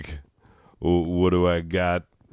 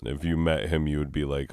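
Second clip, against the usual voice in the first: ordinary delivery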